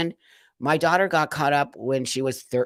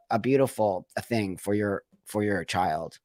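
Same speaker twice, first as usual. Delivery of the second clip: normal talking voice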